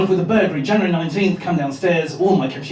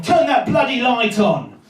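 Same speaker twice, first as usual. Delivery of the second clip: slow, higher-pitched shouted order; warden's shout; 4 semitones higher than usual. Acted. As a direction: yell it up at a house from the street. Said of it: "air raid war-, warden cycling past her house saying".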